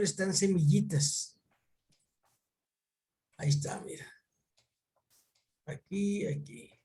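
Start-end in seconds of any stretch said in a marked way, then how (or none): 3.4-6.7 softly whispered to himself while finding the screen; whispered aside. Real, not acted. Whispering to Luis while he shares the screen. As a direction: murmur it under the breath, just for yourself.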